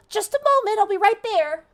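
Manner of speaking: put-on tiny high gnome girl voice; a little voice calling from the back, very high; pixie. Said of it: "you hear a little voice go".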